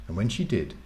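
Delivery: ordinary delivery, nothing marked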